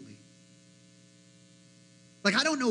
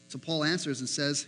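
ordinary delivery

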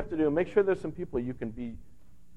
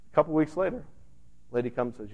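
normal talking voice

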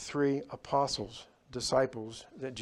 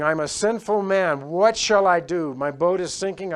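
desperate prayer; 5 semitones higher, 10 dB louder than usual. Acted. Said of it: "They're praying".

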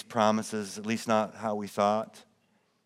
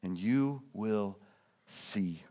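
much softer and slow, a tender come and see; gentle invitation; 4 semitones lower, 9 dB quieter, much slower than usual. Acted. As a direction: invite them in quietly. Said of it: "then he says".

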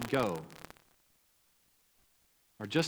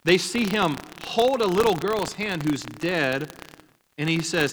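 usual speaking voice

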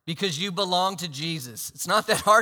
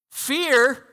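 high, loud mocking question; preacher scoffing at the idea of fear; 7 semitones higher, 9 dB louder than usual. Real, not acted. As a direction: toss it off with disbelief.

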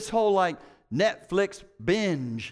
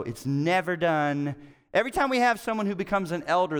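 ordinary talk, nothing marked

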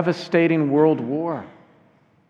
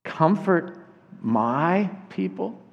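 slow, higher question, lifting at the end; surprised questioning; 4 semitones higher, 4 dB quieter, slower than usual. Acted. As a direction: ask it in wonder, pausing after Comfort. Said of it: "This is a totally unexpected transition".

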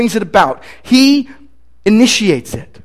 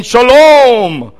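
booming voice; 5 semitones higher, 4 dB louder than usual. Retold or imitated. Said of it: "a little guy with a booming voice".

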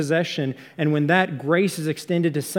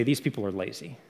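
dismissive judgement; 5 semitones lower, 7 dB quieter than usual. Retold or imitated. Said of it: "predisposed to hear the word idle and say".

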